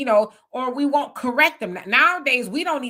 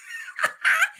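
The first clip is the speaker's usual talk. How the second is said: high burst of laughter. Real, not acted. Laughs at own shape joke.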